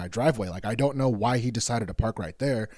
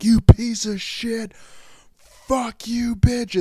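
high, drawn-out shout out the window; re-enacted furious shouting from a window; acted angry yell; 11 semitones higher, 5 dB louder, much slower than usual. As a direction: holler insults at someone outside, pitched way up. Acts out yelling out the window.

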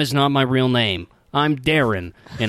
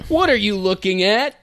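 mom voice; 8 semitones higher than usual. Acted. Reading mom line again.

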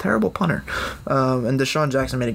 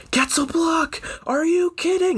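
much higher, excited mock announcer; amazed Joe Buck call; slower than usual. Friend mimics the announcer's amazed call on the game-winning return. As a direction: imitate a TV announcer who can't believe the play. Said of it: "Like, he was actually amazed".

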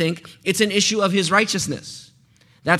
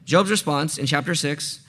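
usual speaking voice

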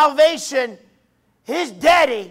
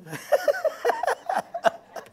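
high, real burst of laughter; giggling fit; 5 dB quieter than usual. Real, not acted. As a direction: lose it laughing mid-sermon. Cracks up again after aside.

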